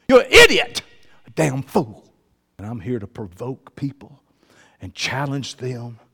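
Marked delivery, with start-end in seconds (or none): 0.1-2.1 loud, high outburst; acted rebuke. Preacher voicing the verdict on a fool, shouted rebuke. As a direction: lash out with a sudden shout.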